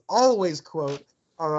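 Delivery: ordinary delivery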